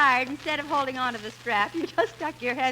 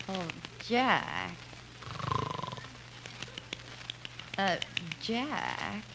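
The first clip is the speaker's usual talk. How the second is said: soft, coaxing call of his name; gentle waking call; 5 semitones lower, 10 dB quieter, much slower than usual. Acted. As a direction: coax him awake quietly, softer each time. Softly waking Jack after suggestion.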